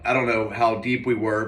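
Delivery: usual speaking voice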